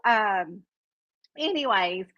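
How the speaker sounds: normal talking voice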